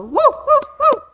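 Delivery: very high, whooping cheer; high woo-hoo cheer. Real, not acted. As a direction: finish with a happy whoop. Cheer after song.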